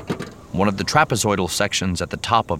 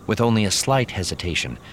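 ordinary delivery, nothing marked

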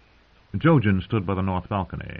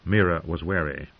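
usual speaking voice

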